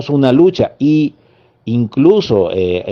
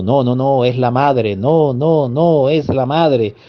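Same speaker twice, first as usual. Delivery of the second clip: half-acted cry, repeated no's in a rising wail; retold desperate cry; 3 semitones higher, slower than usual. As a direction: imitate his desperate shouting without really shouting. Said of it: "salió corriendo y gritando".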